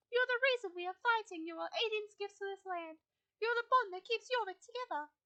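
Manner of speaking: lightly voiced wise guide, comforting; gentle Fripp voice. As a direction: console the grieving rider as Fripp. Player voices Fripp speech.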